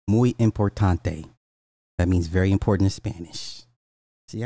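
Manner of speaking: usual speaking voice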